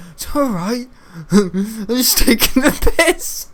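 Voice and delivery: high, laughing mock cry; imitating Jacob. Imitating the classmate stuck in the lift, whiny mock cry. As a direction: whimper it out as the stuck boy. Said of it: "and he's going".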